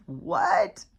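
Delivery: high, squeaked-out what of disbelief; shocked what. Retold or imitated. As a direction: imitate his stunned disbelief. Shocked man in retold story.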